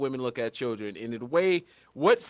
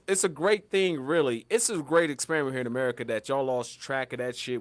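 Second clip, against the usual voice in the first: ordinary talk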